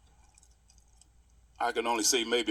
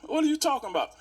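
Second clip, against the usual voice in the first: upset; 13 semitones higher, faster than usual. Retold or imitated. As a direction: fire the question back at him. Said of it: "now I'm upset now".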